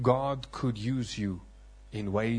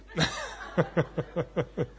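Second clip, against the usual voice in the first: real laughter; 4 semitones higher than usual. Real, not acted. Laughing at wife's reaction.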